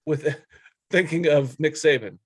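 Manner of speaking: ordinary talk, nothing marked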